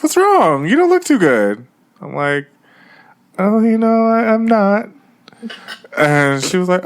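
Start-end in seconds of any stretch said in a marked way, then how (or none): 0.0-1.7 high-pitched fussing mom voice; concerned mother. Retold or imitated. 3.4-4.9 high, halting dodge, acted out; nervous excuse.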